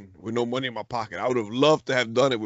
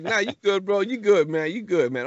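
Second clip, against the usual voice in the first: high-pitched retold reassurance; lifted, consoling insistence; insistent reassurance; 8 semitones higher than usual. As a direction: keep telling him he's fine, voice raised in pitch. Retelling reassuring a crying dad.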